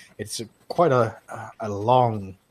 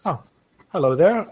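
put-on Nord accent; 7 semitones higher, 4 dB louder than usual. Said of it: "the really thick Nord accent".

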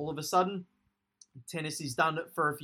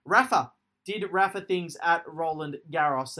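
ordinary delivery

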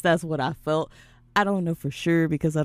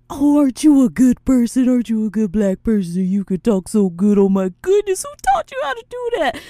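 higher, louder, gushing condescension; 6 semitones higher, 7 dB louder than usual. Retold or imitated. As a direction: coo it like praising a pet. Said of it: "that's the vibe that I get".

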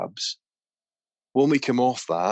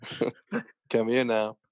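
dad calling out; 3 semitones higher than usual. Retold or imitated. Dad calling the kid, laughing mock call.

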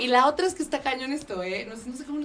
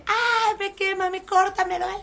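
higher and slow, mock cries of burning pain; replaying the panic of the burn, pitched up; retold pained cry; 10 semitones higher than usual. Retelling the burn to the hosts, slow high mock cry. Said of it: "empecé a gritar así".

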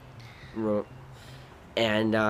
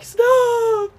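high crying shout; crying yell; 8 dB louder than usual. Retold or imitated. Crying little brother yelling stop, high and loud. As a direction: bawl it out very high.

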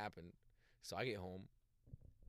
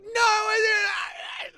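very high, loud and slow hammed-up shriek; mock screaming denial; 21 dB louder, much slower than usual. Teen sister denying it at the dinner table, mock shriek. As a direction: stretch it out in a squealing scream.

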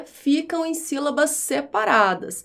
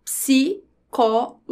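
"Psicólogo" in slow beats, psi, có, lo, go; syllable by syllable; slower than usual. Shown as a demonstration. Teacher keeping P and S together, syllable by syllable. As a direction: separate each syllable of "Psicólogo" with long pauses. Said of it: "Quando eu separo as sílabas".

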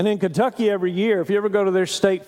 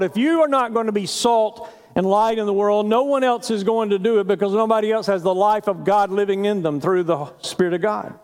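normal talking voice

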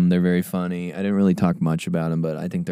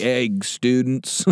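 drawn-out, slow greeting; lingering ex-student's greeting; slower than usual. Acted. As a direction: stretch it out like a hanger-on. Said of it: "just being like".

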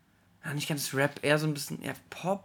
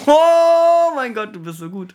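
excited; 11 semitones higher, 12 dB louder, slower than usual. Real, not acted. Guess of the drum intro.

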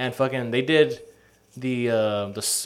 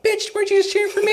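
high, indignant squeaky disbelief; shrill mock outrage; outraged boyfriend; 5 dB louder than usual. Betrayed boyfriend in Saw story.

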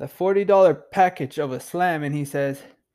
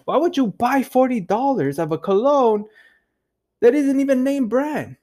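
mocking cousin; 7 semitones higher than usual. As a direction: imitate a cousin ridiculing the price. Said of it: "cracking up, and he's like".

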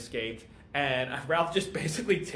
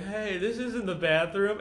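higher, slow mock-drunk voice; drunk character; 6 semitones higher than usual. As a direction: lightly play a confused drunk wandering in. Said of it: "here comes drunk Barry".

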